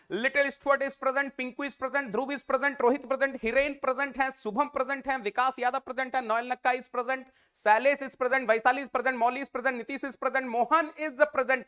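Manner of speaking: ordinary talk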